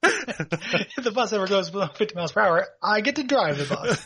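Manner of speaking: high giggly voice through laughter; laughing. Real, not acted. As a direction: push words out while laughing. Laughing retelling of Tyron bus bit.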